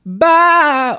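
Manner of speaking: very high, loud sing-song bye; squealed bye. Real, not acted. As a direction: shriek a cutesy goodbye. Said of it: "we're gonna go again".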